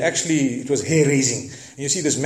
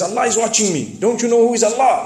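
indignant; 6 semitones higher than usual. Retold or imitated. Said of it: "he got upset".

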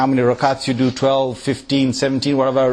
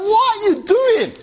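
incredulous. Retold or imitated. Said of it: "you're like".

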